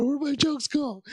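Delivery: high, panicky whine; mock panic. Comic panicking to himself before stage, high whine. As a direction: fret aloud, a hammed-up panic.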